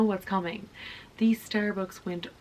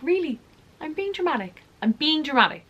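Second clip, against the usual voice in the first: indignant sarcasm; 8 semitones higher, 5 dB louder, faster than usual. Retold or imitated. Indignant reply to boyfriend.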